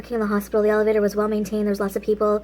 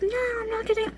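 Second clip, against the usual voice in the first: put-on scared kid refusing; 11 semitones higher, 7 dB quieter than usual. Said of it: "I was like".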